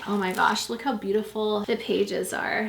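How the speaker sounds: ordinary talk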